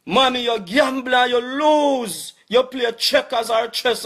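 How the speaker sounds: loud, high and slow shout; angry shout. Real, not acted. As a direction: hammer each word out at her. Berating mother online.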